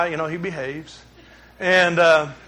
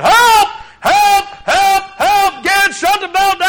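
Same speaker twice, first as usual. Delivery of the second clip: hollering for help; 11 semitones higher, slower than usual. Retold or imitated. Pastor retelling his hand caught in a conveyor, hollering for help. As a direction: yell for help as the belt drags his hand. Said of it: "So I started hollering".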